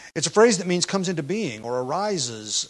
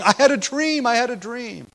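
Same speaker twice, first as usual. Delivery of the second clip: mocking false prophet; 8 semitones higher than usual. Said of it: "prophets who prophesy falsely".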